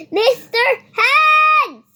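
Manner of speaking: slow, drawn-out loud call with a crying edge; urgent calling out. Acted. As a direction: shout across the room for help, stretched long. Child calls puppet friend urgently.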